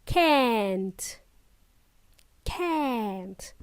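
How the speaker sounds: can't the American way, vowel as in cat; American pronunciation. Shown as a demonstration. Teacher modelling can't for learners, American pronunciation. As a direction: draw out "can't" slowly with an American a. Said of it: "In American English, it is".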